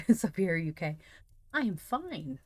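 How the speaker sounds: ordinary delivery, nothing marked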